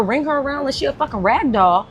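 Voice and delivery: normal talking voice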